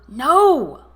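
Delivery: loud sharp cry of no; exclaimed no. A narrator's reading. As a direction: Laurel bursts out in protest, loud exclaimed no. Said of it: "Laurel exclaimed".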